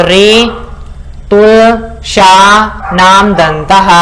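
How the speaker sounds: drawn-out recitation. Shown as a demonstration. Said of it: "spells out the sutra".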